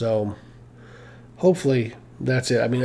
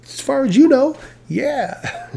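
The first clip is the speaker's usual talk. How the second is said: mock boss check-in; 7 semitones higher than usual. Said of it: "lie right to".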